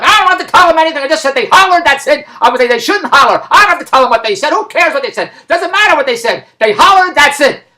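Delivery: high, loud, insistent rant; exasperated refusal. Retold or imitated. Daughter refusing to tell the agency the words, exasperated. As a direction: dig in and dismiss every question.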